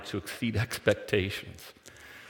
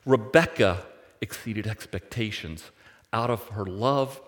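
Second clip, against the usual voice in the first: normal talking voice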